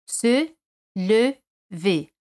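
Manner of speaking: "se lever" drawn out very slowly, syllable by syllable; slow French model. Shown as a demonstration. Teacher slowly models "se lever" for students. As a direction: stretch "se lever" slowly for learners. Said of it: "se se coloca al principio".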